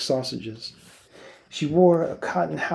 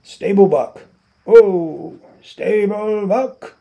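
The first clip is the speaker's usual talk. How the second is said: high, loud call from far off; hollering across the yard; distant call; 8 semitones higher, 9 dB louder, slower than usual. A narrator's reading. A stable hand calling out for the buck, loud and high. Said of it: "came a clear call".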